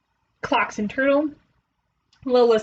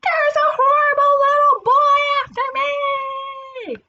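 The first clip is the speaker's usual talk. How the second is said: high, loud, drawn-out yell; panicked shout; 5 dB louder, slower than usual. A narrator's reading. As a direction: raise a false alarm at full volume. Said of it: "he shouted".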